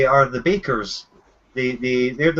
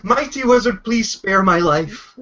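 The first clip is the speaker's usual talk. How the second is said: higher-pitched begging for mercy; mock pleading; 5 semitones higher than usual. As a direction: plead like a captive, slowing at the end. Prisoner begs wizard for his life.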